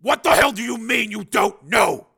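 slow, high, loud roar; angry bellow; furious shout. Acted. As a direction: hammer out each word in disbelief. Major yells at scientist.

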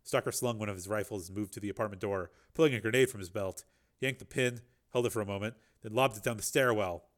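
ordinary delivery, nothing marked

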